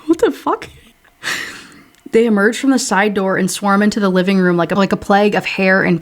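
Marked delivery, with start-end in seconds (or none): 0.1-0.7 high squeak through laughter; real laughing outburst; laughing disbelief. Real, not acted. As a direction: laugh it out in shocked amusement. Reader laughs at sippy cup.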